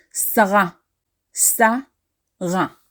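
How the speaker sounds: Sarah as "sa-RA", stress at the end; Hebrew pronunciation. Shown as a demonstration. Teacher showing Sarah in Hebrew, careful "sa-RA". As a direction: say Sarah the Hebrew way, "sa-RA", syllables clear. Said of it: "in Hebrew is pronounced".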